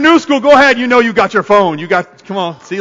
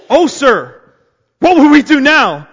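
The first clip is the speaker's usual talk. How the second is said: fearful cry; 5 semitones higher, 5 dB louder than usual. A narrator's reading. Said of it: "the young man cried".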